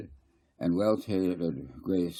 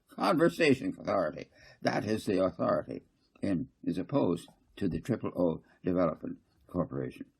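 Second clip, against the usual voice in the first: fussy councillor Garth; faster than usual. Acted. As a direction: press the point, repeating I mean to say. Garth reveals his information.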